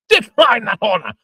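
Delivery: loud, high indignant outburst; outraged shout. Acted. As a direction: fling the words back at him. Father outraged at the refusal.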